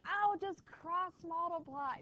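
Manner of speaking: slow, high, lightly mocking student voice; a hint of a smug student. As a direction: parody the know-it-all kid, stretched out. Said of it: "Somebody's always like".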